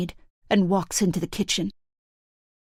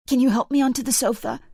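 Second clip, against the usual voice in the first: pleading; 6 semitones higher, much faster than usual. Acted. Injured wife begging, in pain.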